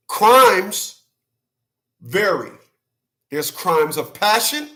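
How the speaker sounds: usual speaking voice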